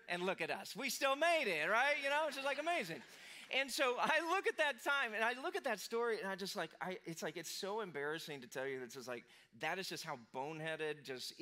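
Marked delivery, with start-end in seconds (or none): 0.8-6.4 higher and louder, lit up with delight; preacher cheering how the marriage lasted; excited. Real, not acted. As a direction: celebrate it like good news. Preacher rejoices over marriage.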